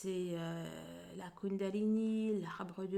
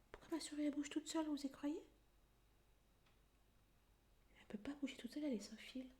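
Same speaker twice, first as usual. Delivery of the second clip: hushed, breathy aside to viewers; whispering; 5 semitones higher, 11 dB quieter, much faster than usual. Real, not acted. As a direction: drop to a secret whisper. Spooked aside about moving mouse.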